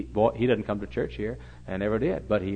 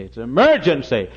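louder, pressing the word emergency; urgent louder plea; 4 semitones higher, 11 dB louder than usual. Retold or imitated. Pastor urging the TV man to come out, louder. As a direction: insist it's an emergency, louder. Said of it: "I said".

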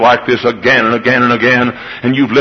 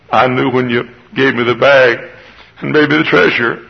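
usual speaking voice

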